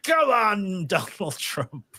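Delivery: drawn-out mock New York lady cheer; hammed-up New York lady; suave New York lady. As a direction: imitate a New York lady, high start, slowing down. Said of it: "this suave New York lady".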